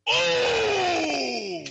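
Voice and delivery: high, loud yelp of mock pain. Real, not acted. Host mocking Buffalo's early off season, loud yelp. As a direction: whoop at the burn on Buffalo. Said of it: "that's painful".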